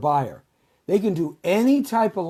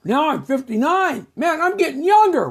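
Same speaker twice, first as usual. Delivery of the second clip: higher younger voice; 9 semitones higher, 4 dB louder than usual. Pretend. Pretending to get younger.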